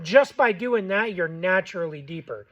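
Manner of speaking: ordinary talk, nothing marked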